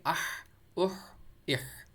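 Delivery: short vowel into a long mid-throat H: اح as "ahh, uhh, ihh"; slow, separated demo of اح; silent ha drill. Shown as a demonstration. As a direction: hold the pharyngeal H at the end of each اح. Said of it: "کیا پڑھیں گے؟".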